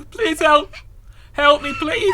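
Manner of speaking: high pleading cry for help; frightened girl begging a stranger to help; scared pleading. Acted.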